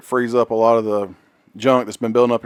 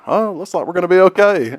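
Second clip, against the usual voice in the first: laughing pilot line; 7 semitones higher than usual. Retold or imitated. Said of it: "the pilot comes back and is like".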